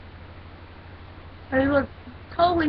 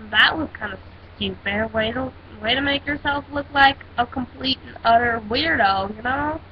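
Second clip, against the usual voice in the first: normal talking voice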